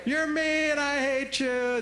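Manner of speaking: put-on high, slow, whiny kid; kid's angry guilt trip. Kid escalating to level three against a parent, high slow whine. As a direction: wail it like a wounded child. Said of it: "level three".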